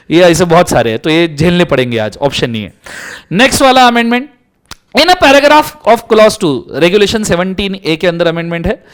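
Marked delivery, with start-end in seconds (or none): 5.0-6.6 slow, higher, louder reading of the clause; teacher reading law aloud; slow legal read. Acted. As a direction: read the clause out slowly and deliberately. Reading statute text.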